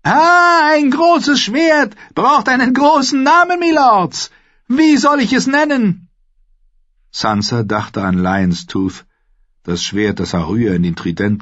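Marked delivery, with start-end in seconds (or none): 0.0-6.1 very high, loud, crowing royal shout; high-pitched excited proclamation; excited boy king. A narrator's reading. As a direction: crow it out to the whole hall.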